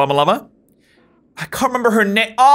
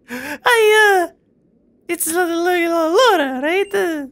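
female Russian accent; 12 semitones higher, slower than usual. Shown as a demonstration. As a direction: falter high in a mock Russian lady voice. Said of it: "Russian female is hard to do".

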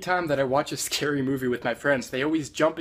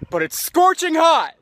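higher, louder, slow outburst; loud complaint; 11 semitones higher, 9 dB louder, slower than usual. Acted. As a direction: wail about the heat. Complaining about heat.

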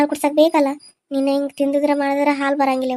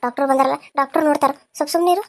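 ordinary talk

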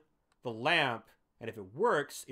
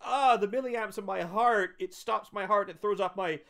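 dying man voice; 7 semitones higher, 6 dB louder than usual. Retold or imitated. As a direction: gasp it out like a cartoon death scene. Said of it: "He explains it as he's dying".

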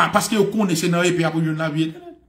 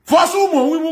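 starts high, falls to usual pitch; exclaimed; 10 semitones higher, 4 dB louder than usual. Real, not acted. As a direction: launch it high, then come down. Line exclaimed, later repeated low. (rele l byen wo, epi desann)

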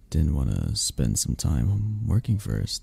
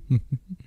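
quick, higher-pitched little giggle; short giggle; 6 semitones higher than usual. Acted. Charmed by the partner's blushing, short giggle. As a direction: give a brief, charmed giggle.

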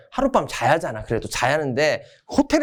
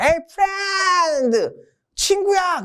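high, hammed-up call to a pal; mock-friendly high call; hearty friend greeting; 14 semitones higher than usual. 미국이 한국을 친구라 부름.

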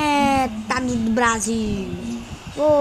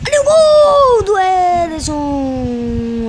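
loud, slow goal call; explosive goal commentary; 3 semitones higher, 5 dB louder, slower than usual. Real, not acted. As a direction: stretch it out, celebrating. Celebrating goal replay.

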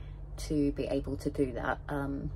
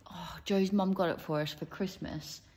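ordinary delivery

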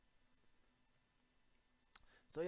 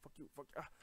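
ordinary talk

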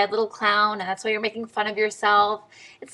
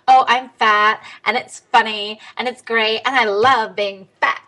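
louder, hammed-up jokey self-mockery; mock self-deprecation; 7 dB louder than usual. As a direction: parody a comedian laughing at herself. Mocking self-deprecating comedian.

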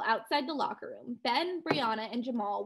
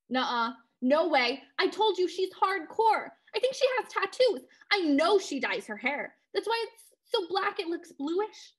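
alarmed protest; 7 semitones higher, 4 dB louder than usual. Real, not acted. Brianna refuses, alarmed.

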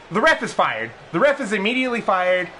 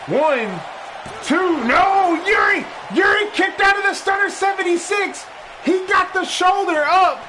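higher, louder call of the kickout; excited shout; 12 semitones higher, 3 dB louder than usual. Acted. Announcer shouting as the opponent kicks out. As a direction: yell it as the pin fails. Near-fall kickout in commentary.

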